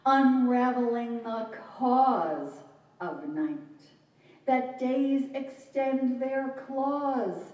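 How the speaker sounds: very slow, drawn-out, intoned verse; high, sustained chant-like reading, vowels held long; poetry recital. Acted. Poet performing formal verse to an audience, slow and incantatory.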